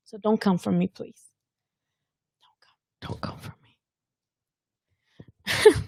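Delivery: breathless, voiceless whisper; whispered plea not to come at her; playful whisper. Pretend. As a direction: hush it like a teasing secret. Pleading jokingly to critics, whispering.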